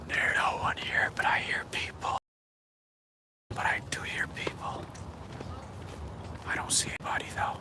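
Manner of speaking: hushed, unvoiced whisper; real whisper, barely audible. Real, not acted. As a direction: whisper warily to the viewers while walking alone. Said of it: "Why am I whispering?".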